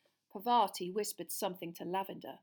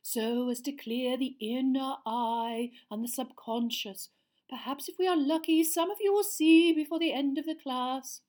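dreamy seer; 6 semitones higher, 9 dB louder than usual. A narrator's reading. Seer speaking dreamily to her class. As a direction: float each word out like a seer in a trance. Trelawney voice held.

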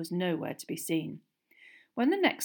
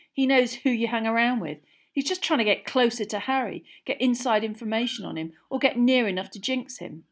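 raised, loud argument; accusing; 5 semitones higher, 7 dB louder than usual. A narrator's reading. Boy warning that her date is spying, accusing. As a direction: press each suspicion home loudly. Ron presses accusation in quarrel.

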